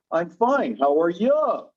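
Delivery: "you" in "How are you" as a full, stressed "yuh"; imitating niece's odd pronunciation. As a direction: stretch and lift the final "you" into a heavy "yuh". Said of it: "doesn't that sound a little funny?".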